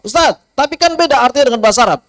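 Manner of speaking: a hint of a student's high voice, objecting; lightly voiced pupil protesting; suara murid protes. Murid membantah ustaz. (suara murid yang sedikit tinggi, membantah)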